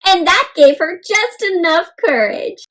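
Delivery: plain reading voice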